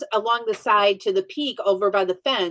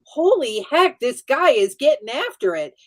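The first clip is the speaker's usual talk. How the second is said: raised pitch, acted-out astonishment; lively mock amazement; amazed reaction; 5 semitones higher, 4 dB louder than usual. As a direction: marvel at it, retelling your reaction.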